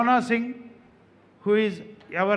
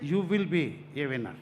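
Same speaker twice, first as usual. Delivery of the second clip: ordinary talk, nothing marked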